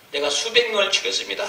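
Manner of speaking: high, loud pleading confession; wailing confession. Retold or imitated. Pastor confessing to God in remorse, high pleading cry. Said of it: "통곡이 나오는데".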